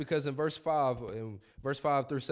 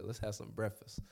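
quietly savoring the line; hushed, fond echo; soft, relished repeat; 4 semitones lower, 9 dB quieter than usual. Retold or imitated. Pastor savoring Jesus's words, softly echoing them. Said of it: "That's my type of Lord".

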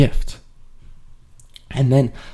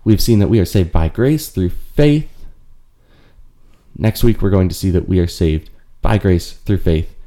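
upbeat, a little louder sign-off to listeners; podcast outro; 5 dB louder than usual. Acted. Host signs off the podcast episode to listeners, bright outro read. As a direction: warmly invite listeners to like and subscribe.

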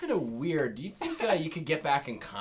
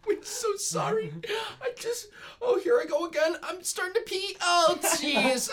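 high frightened whining; terrified whimper. Acted. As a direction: whine in terror. Scared kid apologizes.

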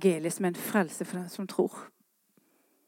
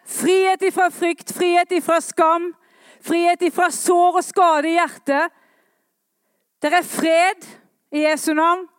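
loud, high, rousing call from the pulpit; fiery revival preacher; full-force preaching; 8 semitones higher, 6 dB louder than usual. Acted. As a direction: proclaim it to the whole church, ringing out.

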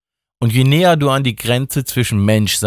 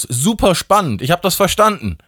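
high, mock-eager reply; Justus voice; 10 semitones higher than usual. A narrator's reading. Justus assures god Justin he listened, high and mock-eager. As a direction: fake interest, clearly bored. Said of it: "sagte Justus".

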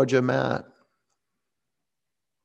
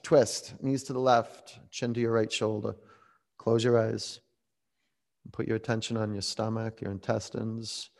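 guided relaxation; 3 semitones lower, 7 dB quieter than usual. Acted. Quiet guided relaxation in twist.

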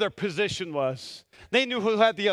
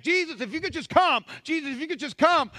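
higher, insistent mock plea; quoted urgent plea; 8 semitones higher than usual. Father begging Jesus to come to his daughter, acted urgent plea. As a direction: plead it with rising urgency, retold.

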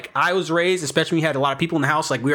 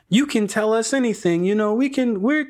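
high, sing-song reassurance rising higher as it goes; mock welcoming housemates; 10 semitones higher than usual. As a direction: parody of overly friendly housemates, pitched way up. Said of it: "you know what people say every time".

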